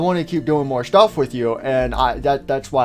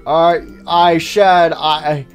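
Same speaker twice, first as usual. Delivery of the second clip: slow, loud, forced restart; speaking up; 7 dB louder, much slower than usual. Acted. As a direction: push the voice out, then falter. Repeats after being told speak up.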